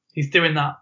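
usual speaking voice

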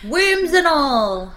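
a gleeful slow shout; loud slow exclamation. Real, not acted. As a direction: belt it out, dragging each word. Jokey exclamation at intro.

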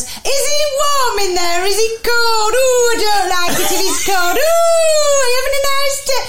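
high, loud chatty lady voice; hammed-up high-pitched nattering woman; imitating a woman. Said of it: "and says".

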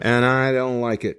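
nose pinched shut voice; pinching nose. Pretend. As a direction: speak holding your nose closed. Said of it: "with a clothespin on your nose".

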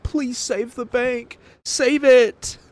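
mocking whine. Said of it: "and then they're like".

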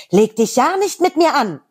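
a hint of a feisty teen heroine; Kim Possible threat. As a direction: snap back as the plucky teen heroine. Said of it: "Dann kommt natürlich Kim Possible und sagt".

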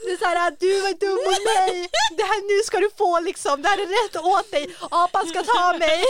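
high, loud, laughing near-cry; hammed-up kid telling mom off; whiny scolding kid. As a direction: sulk loudly at mom.

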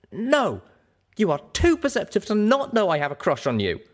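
high, sharp, angry rebuke; cold and sharp. A narrator's reading. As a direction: cut her down coldly. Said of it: "my voice cold and sharp".

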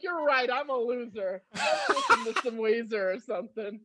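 laughing, giving in; laughing self-mockery; laughing defeat. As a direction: concede it through a laugh. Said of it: "And I'm like".

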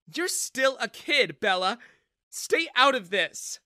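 high, loud, slow dismissive rebuke; stern rebuke. A narrator's reading. Mother rebukes her daughter, stern and loud. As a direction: put the child in her place. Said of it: "Jessica frowned".

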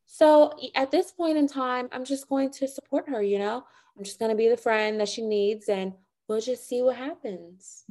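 high-pitched mock socialite voice; Meredith. Impersonating a castmate standing by her friend, high affected voice. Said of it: "That's my Meredith impression".

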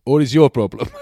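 loud, high mock Romanian challenge; comic Romanian builder, pitched right up; Romanian accent. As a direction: demand it in a put-on Romanian accent. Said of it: "is that a Romanian accent".

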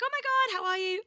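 thrilled to bump into them; excited greeting. Retold or imitated. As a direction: squeal a delighted greeting. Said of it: "And I was like".

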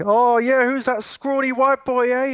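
high, loud-starting Kiwi impression; teasing put-on Kiwi voice; mock Kiwi accent. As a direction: imitate New Zealand in-laws in a drawn-out, high Kiwi accent. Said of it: "So when I go over there".

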